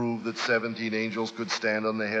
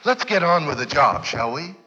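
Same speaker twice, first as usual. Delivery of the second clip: louder, higher, driving pulpit cry; preaching at full force; 9 semitones higher, 9 dB louder than usual. Acted. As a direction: preach it out to the whole hall.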